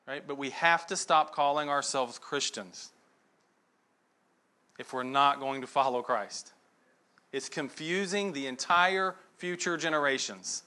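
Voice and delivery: normal talking voice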